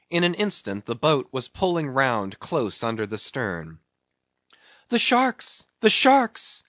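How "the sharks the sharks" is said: very high-pitched alarmed cry; cried warning. A narrator's reading. Voice from the cabin window warns of sharks, cried warning. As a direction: call out the danger in a high, urgent voice. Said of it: "cried a voice from the low cabin window".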